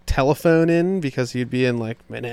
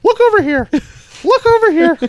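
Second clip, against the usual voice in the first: high, loud bird-call voice; caw, then call them over high and loud; chirping bird person; 6 dB louder than usual. Retold or imitated. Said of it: "he's like".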